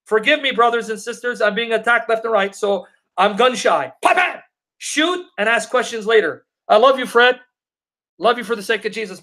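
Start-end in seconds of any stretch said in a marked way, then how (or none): 4.0-4.4 high, punchy "bam, bam"; play-fire two shots with the voice; sudden high-pitched gunshot imitation; mimicked gunshots. Mimics gunshots after "gun shy.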